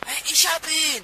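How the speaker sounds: laut und hoch, triumphierend rufen; er hat ihn gepackt, laut jubeln; aufgeregter Triumphruf. Acted. Peter packt den Eindringling. (Peter packt den Eindringling: lauter Triumphruf)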